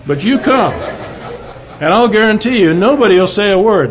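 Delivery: retold emphatic promise; insistent. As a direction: push him hard to come along. Charlie insisting on chapel.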